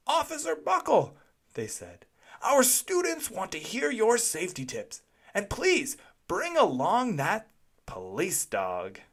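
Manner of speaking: eager callers. A narrator's reading. Callers asking for the speech.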